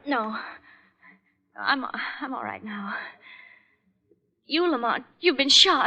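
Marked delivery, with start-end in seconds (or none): 0.1-2.9 tearful, breathless whisper; shaken whisper. Acted. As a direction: force the words out, still trembling. Shaken after being choked.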